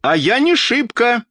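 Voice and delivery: high-pitched proud reply; lofty old-lady retort; dignified retort. A narrator's reading. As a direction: answer back with dignity, voice high. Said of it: "с достоинством отвечала Анна Петровна".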